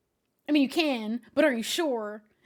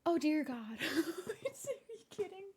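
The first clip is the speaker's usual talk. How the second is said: laughing disbelief; 6 semitones higher, 5 dB quieter, much slower than usual. Real, not acted. Shocked by gifted subs.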